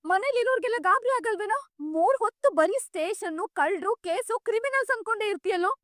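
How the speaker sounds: scolding. Acted. Elder telling off a man for always coming home late, scolding.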